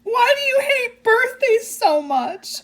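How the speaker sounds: high, giggly read through laughter; reading aloud, cracking up; high laugh-choked reading; laughing while reading. Real, not acted. Reads comment while laughing.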